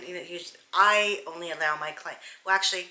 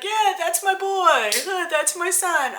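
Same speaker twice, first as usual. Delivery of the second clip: much higher, louder proud dad voice, laughing; proud drunk dad; 11 semitones higher, 6 dB louder than usual. Retold or imitated. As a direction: ham up a drunk father bragging about his boy. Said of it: "the father is like".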